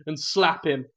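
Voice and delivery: ordinary delivery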